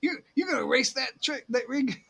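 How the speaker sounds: half-laughing Louisiana impression; Louisiana accent. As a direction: try out a Louisiana accent, amused. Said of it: "I think I can tell the Louisiana accent".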